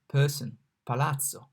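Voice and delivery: person then palazzo, same P; comparison demo. Shown as a demonstration. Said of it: "like P in person".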